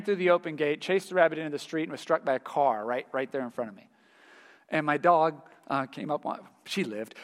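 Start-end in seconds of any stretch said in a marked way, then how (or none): 5.7-7.1 quiet, voice catching near tears; starting to cry. Real, not acted. Pastor retelling his hurt dog, quietly near tears. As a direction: choke up on the memory. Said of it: "I was starting to cry there".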